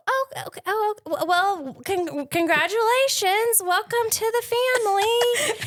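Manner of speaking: high, teary, laughing congratulations; flustered happy welcome. Retold or imitated. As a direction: stammer a shocked, weepy welcome. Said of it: "I go".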